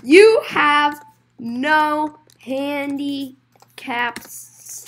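very slow, drawn-out, broken-up delivery; slow word by word. Acted. Telling a customer about the missing sticker, slowly. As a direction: draw out each word with gaps.

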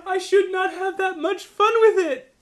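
high, giggling admission; laughing. Real, not acted. Player confessing she enjoyed the carnage, laughing. Laughing about enemy's pleas.

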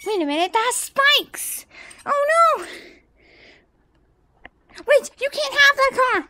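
high, loud panicked warning; high-pitched urgent alarm. Acted. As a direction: cry out to stop a child getting hurt. Amy alarmed at spikes.

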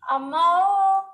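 loud, high wail ending in a cry; beggar's wail. A narrator's reading. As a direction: call out pleading from the gate. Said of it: "came a wail from the gate".

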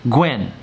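'Gwen' in a short, sharp fall; exact fourth tone. Shown as a demonstration. Teacher modelling the word for repetition, exact falling tone. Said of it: "with that exact tone".

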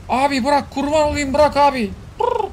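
high pleading sidekick voice; voiced pet begging. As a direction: plead to be let go. Voicing Samet pleading.

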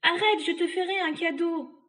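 high, loud bargaining cry; pleading witch. Acted. Trapped witch to the boy, high pleading offer. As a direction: beg with a bribe.

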